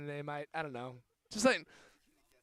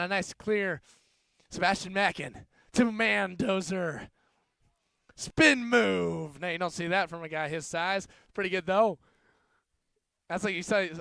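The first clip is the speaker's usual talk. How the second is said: excited rugby commentary, higher and louder; live play-by-play; 4 semitones higher, 8 dB louder than usual. Acted. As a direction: call every pass with rising excitement.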